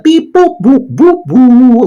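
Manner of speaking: high mock beep-boop synth notes; cheap synth beeps. Reviewer mocking the film's cheap score, high beep-boop. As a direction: imitate cheesy electronic score. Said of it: "cheap electronic".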